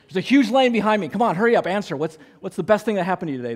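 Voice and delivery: high, loud, fast pretend rush; mock impatient hurry. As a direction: hurry her up in mock urgency. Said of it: "I'm just playing with her".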